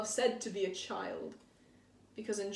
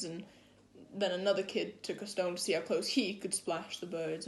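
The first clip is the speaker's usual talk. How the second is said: normal talking voice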